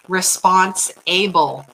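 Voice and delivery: Response-able said slowly, with a clear break between response and able; split into two words. Shown as a demonstration. Teaching self-help viewers, she slowly splits the word Response-able. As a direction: stretch out Response-able so both halves stand apart. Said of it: "You are able to be responsible".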